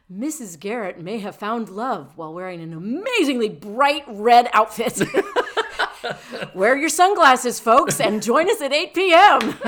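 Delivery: loud, high, laughing promo voice; TV guide promo. Pretend. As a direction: hype it like a TV announcer. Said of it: "Like what you might see in the T V guide".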